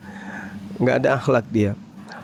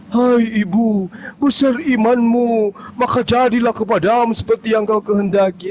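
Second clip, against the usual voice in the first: solemn Jesus voice; 7 semitones higher than usual. Retold or imitated. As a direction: grant it like a lofty master. Voicing Jesus speaking. (suara Yesus yang khidmat)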